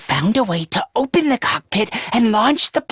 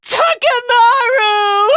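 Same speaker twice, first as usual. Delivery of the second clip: high, stretched-out shout of a name; drawn-out cry; 8 semitones higher, 3 dB louder, slower than usual. Acted. Fighter crying out Takamaru's name, long high shout.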